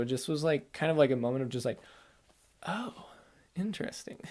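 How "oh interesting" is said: soft breathy whisper; awed whisper to herself; whispered realization. Retold or imitated. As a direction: hush it like a secret dawning. Retold quiet realization.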